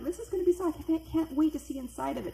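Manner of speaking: breathless-with-eagerness gushing, high; retold excitement. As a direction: act out barely contained anticipation. Can't wait to look inside.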